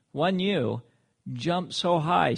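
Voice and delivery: ordinary talk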